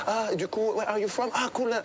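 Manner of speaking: mock the nervous guy sucking up to a girl; too nice, needy voice. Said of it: "on prend une voix un peu trop, euh, gentille, euh, needy".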